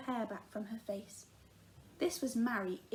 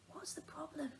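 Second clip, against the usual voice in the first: hushed whisper to the calf; timid unvoiced whisper, afraid to startle it; 5 semitones higher, 12 dB quieter than usual. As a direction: breathe it out, barely voiced. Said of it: "she whispered".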